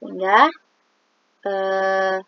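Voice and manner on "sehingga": usual speaking voice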